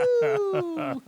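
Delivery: very high, loud laughing woo-hoo; gleeful whoop. Real, not acted. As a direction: whoop like riding the wind. Flying on the motorbike.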